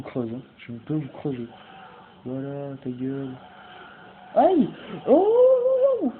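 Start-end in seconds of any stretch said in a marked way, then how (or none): 4.3-4.7 sudden high, loud "ow"; yelp of pain. Real, not acted. Hit in the game. 5.1-6.1 loud, drawn-out high howl; long high scream. Real, not acted. Hit in the game.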